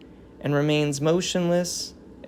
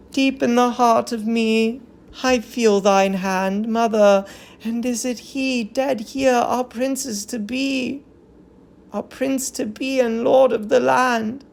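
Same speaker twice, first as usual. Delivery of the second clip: verse lament, somewhat higher; a touch of keening women; mourning chorus; 8 semitones higher than usual. Acted. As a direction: grieve aloud for the prince.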